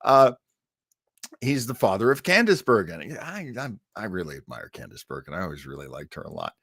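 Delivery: normal talking voice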